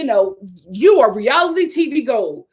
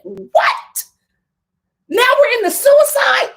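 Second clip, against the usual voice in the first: shocked disbelief; 11 semitones higher, 4 dB louder, slower than usual. Retold or imitated. Recapping the show to viewers, high shocked disbelief. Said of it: "And I'm like".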